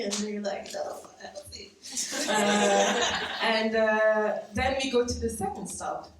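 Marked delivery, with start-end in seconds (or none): 0.7-1.7 whispered aside. Real, not acted.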